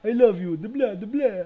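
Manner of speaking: high, loud vampire declaration; Dracula. Acted. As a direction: declare love as a hammy vampire. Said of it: "It's fucking Dracula".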